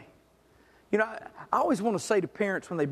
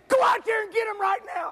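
high, loud order from the window; wife shouting at the husband to fetch the boys; wife's shout; 12 semitones higher, 3 dB louder than usual. Retold or imitated. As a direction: yell it like a mother calling the boys in. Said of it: "And so Janice says".

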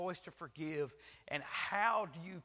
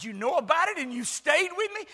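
high, loud disbelief; shocked husband; 12 semitones higher, 13 dB louder than usual. Acted. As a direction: ask it stunned and loud.

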